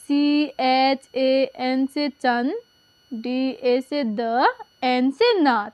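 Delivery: slow letter by letter; patient teacher spelling. Shown as a demonstration. Teacher slowly spells a name for pupils. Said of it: "तो देखिए".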